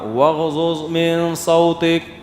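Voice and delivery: slow chanted Arabic recitation; Quranic recitation. Acted. Preacher reciting the verse to the congregation, slow chant. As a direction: recite the verse slowly and melodically.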